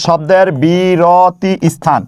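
slow, deliberate teaching emphasis on শব্দের বিরতি স্থান; slow emphatic repeat. Shown as a demonstration. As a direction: draw out শব্দের বিরতি স্থান slowly, word by word. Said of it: "অক্ষর মানে শব্দের বিরতি স্থান". (শব্দের বিরতি স্থান ধীরে টেনে বলা)

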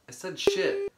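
high-pitched indignant shout; shrill yell. Acted. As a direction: shriek the correction back at him. Acted bathroom quarrel.

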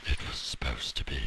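deep, creepy whisper. Shown as a demonstration. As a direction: whisper low to spook everyone. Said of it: "that was very scary".

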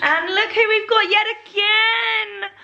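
high, drawn-out excited reveal; excited introduction. Real, not acted. Vlogger presenting a returning friend to viewers, excited. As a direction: sing out a guest's arrival, slow and high.

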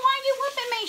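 high-pitched, indignant wail; retold child's protest. Child to mama being whipped, high wailing protest.

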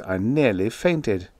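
usual speaking voice

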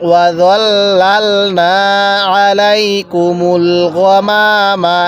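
long drawn-out chanted recitation, every vowel stretched; slow Quran recitation. Shown as a demonstration. Teacher models the verse for students, slow drawn chant. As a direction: intone it slowly, as the teacher's model reading. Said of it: "কিভাবে পড়ব?".

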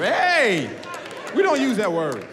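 high-pitched, flustered parental correction; retold dad's embarrassed reprimand; acted scolding. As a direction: correct the child urgently in front of guests. Dad scolds toddler before guests.

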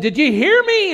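very high, full-force preacher shout. Retold or imitated. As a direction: holler the question at the whole room. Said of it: "he yelled all the louder".

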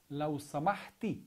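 لو سمحتي with the feminine ending ي on سمحتي; female form. Shown as a demonstration. Teacher models the feminine form لو سمحتي. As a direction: stress the final ي of سمحتي. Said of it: "in Arabic we have a difference between male and female".

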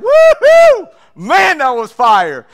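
excited disciples. Retold or imitated. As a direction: cheer like you just won big. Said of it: "They're excited".

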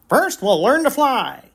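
put-on rally the young fawns; coach voice.

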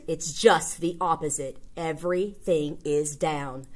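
ordinary talk

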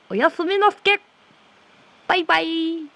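very high playful squeaky farewell; silly high sign-off. Pretend. Streamer signing off to viewers, silly high-pitched voice. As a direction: squeal goofy goodbyes that turn into nonsense.

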